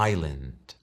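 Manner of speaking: island said low, /ailend/, with a silent s; English pronunciation. Shown as a demonstration. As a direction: say island without the s. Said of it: "En inglés suena".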